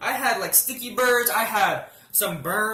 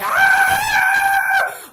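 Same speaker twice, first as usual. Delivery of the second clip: long piercing scream, much higher and louder; 12 dB louder than usual. Retold or imitated. Scraped over concrete, reliving her scream. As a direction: belt out a pained yell. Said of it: "And then I'm screaming".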